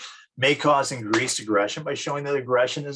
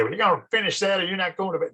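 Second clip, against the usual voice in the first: higher, fast scolding parent; stern parent; 8 semitones higher, faster than usual. Retold or imitated. Parent forcing a seven-year-old to finish Brussels sprouts, stern. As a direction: lay down the law at the dinner table.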